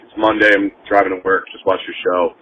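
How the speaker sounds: ordinary delivery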